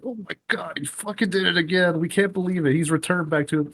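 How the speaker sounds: high-pitched excited disbelief; crowd disbelief. Retold or imitated. Fans thinking Sting is back, high and amazed. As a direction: gasp it like a fan who can't believe it. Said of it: "you know, like".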